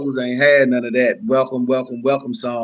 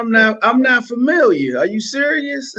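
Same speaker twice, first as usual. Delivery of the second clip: mock disbelief; 9 semitones higher than usual. Mock ignorance of [name].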